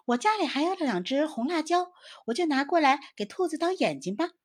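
high, eager little squirrel voice, lifted well above the narration; a bright small animal offering help. A narrator's reading. Squirrel offers friends red chilies, high chirpy voice. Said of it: "小松鼠就说".

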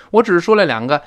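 usual speaking voice

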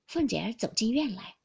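usual speaking voice

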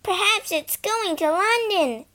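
put-on high, loud girl's yell. Girl yelling to her brother over the train noise. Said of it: "Phyllis yelled".

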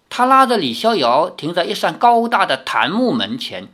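ordinary delivery

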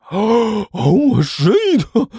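high, drawn-out yelp; shocked curse. Acted. Zhang Yefei takes another slap, shocked yelp. Slapped in the face.

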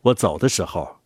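ordinary delivery

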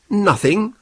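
a sharp disbelieving rise on Nothing; incredulous cry. A narrator's reading. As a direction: throw the word back in disbelief. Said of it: "cried Holmes".

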